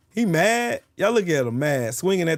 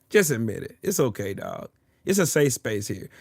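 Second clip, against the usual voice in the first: usual speaking voice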